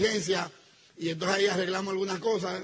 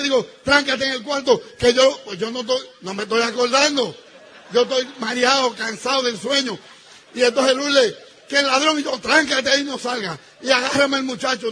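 loud, higher urgent commands; frantic shouting; 4 semitones higher, 8 dB louder than usual. Retold or imitated. Husband telling wife to lock herself in, frantic loud shouting. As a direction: bark orders in a half-asleep panic.

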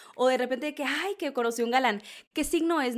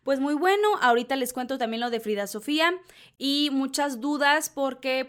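usual speaking voice

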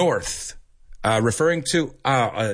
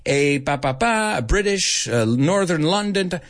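lightly voiced teacher, pitched higher; retold teacher; 7 semitones higher than usual. Speech teacher setting accent tasks, lightly imitated teacher. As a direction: hand out the task in a slightly raised teacher voice. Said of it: "the teacher would say".

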